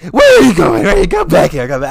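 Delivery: loud high calling voice; loud high dog-owner call; calling after the dog. Retold or imitated. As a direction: call the dog back, mock-frantic. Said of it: "He's like".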